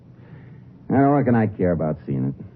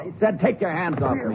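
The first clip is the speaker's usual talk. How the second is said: angry protest; 12 semitones higher, 4 dB quieter than usual. Acted. Prisoner resists being handcuffed.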